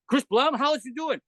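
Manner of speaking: suddenly all friendliness at the name; eager. Retold or imitated.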